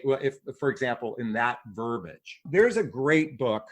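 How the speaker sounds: ordinary talk, nothing marked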